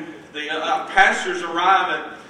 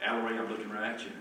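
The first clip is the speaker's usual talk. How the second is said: ordinary talk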